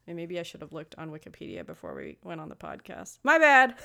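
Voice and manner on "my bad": loud laughing apology. Real, not acted. Laughing admission.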